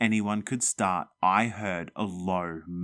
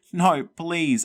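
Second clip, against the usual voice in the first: weak call; 8 semitones higher, 6 dB louder than usual. A narrator's reading. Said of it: "I called after them weakly".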